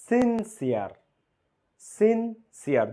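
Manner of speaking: সিনসিয়ার split as sin, pause, siar; two separate syllables. Shown as a demonstration. Teacher counting syllables for students, সিনসিয়ার said in two separated halves. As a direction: break সিনসিয়ার in two with a clear gap. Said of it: "দুই syllable".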